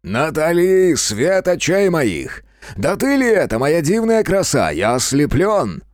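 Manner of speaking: loud, high, drawn-out theatrical greeting; grandly gushing, slow and ringing; trumpeting boom. A narrator's reading. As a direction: boom out extravagant compliments like a trumpet. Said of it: "колокольчик наполнился его трубным басом".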